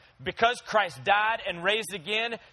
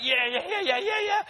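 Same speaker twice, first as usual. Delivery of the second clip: higher and higher happy yapping; a puppy tripping over himself to come; eager dog voice; 13 semitones higher than usual. Pretend. The puppy answering his owner's call, rising high and eager.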